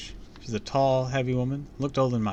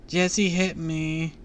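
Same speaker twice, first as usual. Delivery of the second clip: whining; 7 semitones higher, 5 dB louder, much slower than usual. Acted. Crying child complaining.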